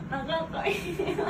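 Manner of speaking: slow, tearful words; choked up and teary; crying softly. Real, not acted.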